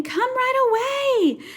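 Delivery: high, loud slow call; boy yelling for help; shouting. Acted. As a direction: drag out the urgent plea. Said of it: "shouted Chang".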